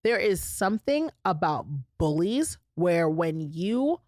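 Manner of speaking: slowed right down to land the point; slow and deliberate, making sure it sinks in. Real, not acted. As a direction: lean on every word, unhurried. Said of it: "we're gonna pause here so that you can hear".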